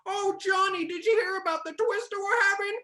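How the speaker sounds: very high, fast hammed-up old-movie voice. Kansas local telling [name] about a twister, high mock starlet voice. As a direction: squeal it like an old film heroine. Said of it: "talks like old [name]".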